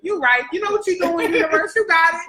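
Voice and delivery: high, laughing praise; excited praise to the creator; thanking the universe. Retold or imitated. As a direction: gush to the universe. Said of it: "I be like".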